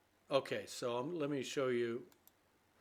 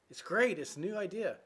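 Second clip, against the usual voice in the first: higher and louder, gushing; excited girlfriend; 7 semitones higher, 6 dB louder than usual. Acted. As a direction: insist it's a brand-new idea. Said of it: "And she says".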